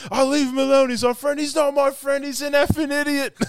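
high, loud heated arguing; acted quarrel. Retelling housemates scrapping after the insult, high angry shouting. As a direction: yell it back and forth.

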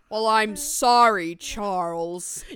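mock father bellowing at his son; loud mock dad. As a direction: boom it like a pompous father. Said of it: "And he's like".

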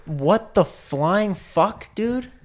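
slow, high-pitched exasperation; exasperated disbelief. Real, not acted. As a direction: draw out the outrage, pitch climbing. Exasperated rant after the reveal.

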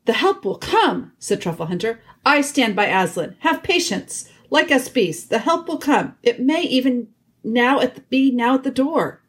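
high-pitched loyal badger voice; steadfast badger. A narrator's reading. Truffle Hunter stands by Aslan before the council, steadfast. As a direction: plead for patience, pitched well up.

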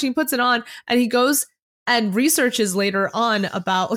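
ordinary delivery

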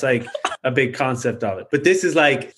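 usual speaking voice

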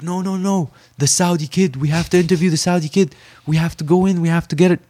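high, insistent crowd voice; clamouring reporters. Retold or imitated. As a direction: imitate pushy press begging to get in. Imitating press clamouring at the door.

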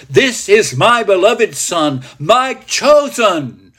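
high, slow, ringing proclamation; voice of God. Acted. God declares his son from the cloud, grand proclamation. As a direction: proclaim it grandly, stretching the words. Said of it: "You hear the voice of God himself".

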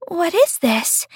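high, wondering star voice; curious little star. A narrator's reading. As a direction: ask in hushed wonder. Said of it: "asked the star".

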